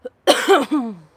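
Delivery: loud sharp coughing fit; choking cough. Real, not acted. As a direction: cough hard, choking on tea. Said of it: "why I'm choking on my tea".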